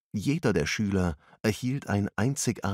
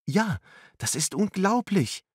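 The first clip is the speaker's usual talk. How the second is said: put-on much higher girl's voice, amazed; excited exclamation; 12 semitones higher than usual. A narrator's reading. Angela marvelling at the lesson to friends, high and amazed. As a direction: cry out in wonder. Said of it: "rief Angela aus".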